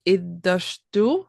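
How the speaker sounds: very slow, careful repeat of Iddashtu; careful learner repeat. Shown as a demonstration. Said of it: "Can I try to say it after you".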